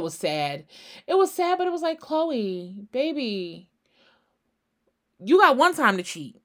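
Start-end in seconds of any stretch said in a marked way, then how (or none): 1.1-2.0 pitched way up, high and excited; squealed aside. Real, not acted. Sudden high exclaim. 2.0-6.4 louder on the last line, scolding her; calling out to Khloé. Real, not acted.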